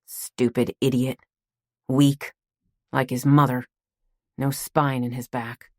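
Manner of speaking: slow, lightly voiced old man, muttering scorn; a hint of a bitter grandpa, dreaming; bitter old grandfather. Grandfather half-asleep, cursing his son, slow and scornful.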